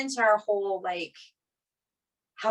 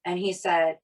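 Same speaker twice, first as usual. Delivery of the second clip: ordinary talk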